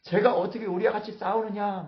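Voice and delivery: high-pitched acted protest; outraged uproar. Philistine lords objecting to David, outraged uproar. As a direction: object in shocked disbelief. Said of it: "막 난리가 난 거예요".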